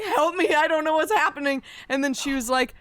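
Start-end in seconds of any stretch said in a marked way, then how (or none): 0.0-1.6 panicked plea. Retold or imitated. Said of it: "And I was like".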